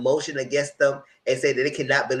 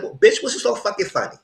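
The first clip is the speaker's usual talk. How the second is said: higher, sharp, indignant snap at the juror; heated retort; 9 semitones higher, 3 dB louder than usual. Retold or imitated. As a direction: demand an answer, getting heated. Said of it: "I'm getting heated".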